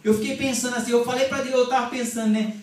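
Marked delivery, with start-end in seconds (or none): none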